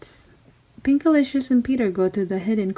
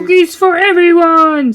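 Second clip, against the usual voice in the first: much higher, louder jolly roar; pirate captain cheer; 9 semitones higher, 9 dB louder than usual. Acted. Captain announcing cookies to the crew, loud jolly pirate cheer. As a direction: bellow it out to the whole crew. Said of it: "said the Captain Pinkbeard".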